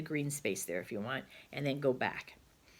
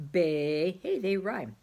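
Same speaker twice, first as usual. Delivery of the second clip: ordinary talk, nothing marked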